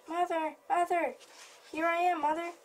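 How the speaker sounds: calling to mother. Acted. Baby bird running up, calling.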